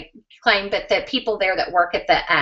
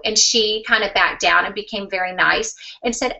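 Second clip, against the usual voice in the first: ordinary talk, nothing marked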